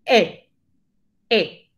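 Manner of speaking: "E" said through the mouth shape of A; E con boca de A. Shown as a demonstration. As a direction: repeat an open French "E", separately, jaw dropped. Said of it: "Ponemos boca de A". ("E" dicha con la boca en posición de A)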